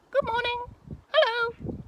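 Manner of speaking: very high, squeaky puppet owl. Pretend. Owl puppet greets the children, high and squeaky. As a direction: pipe it up high like a tiny shy owl. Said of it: "he's gonna say good morning to you".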